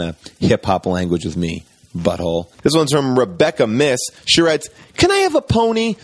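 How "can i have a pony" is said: put-on higher, pleading little girl; child asking. Little Rebecca asking her parent for a pony, higher. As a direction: ask like a hopeful kid.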